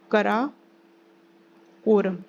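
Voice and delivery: Karakoram in two slow parts, long pause before quorum; slow dictation. Shown as a demonstration. Teacher names the range for students, Karakoram slowly broken in two. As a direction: spell out Karakoram by ear, very slowly.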